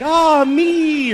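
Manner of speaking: high, airy sigh of pleasure; mock blissful high sigh; dreamy woman. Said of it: "They're like".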